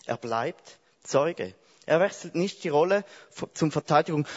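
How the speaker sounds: ordinary talk, nothing marked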